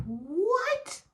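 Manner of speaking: very high, rising squeal of disbelief; hammed-up high shriek of a question; incredulous. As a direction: shoot the pitch right up, baffled. Disbelief at the cheater's excuse.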